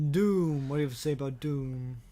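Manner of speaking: usual speaking voice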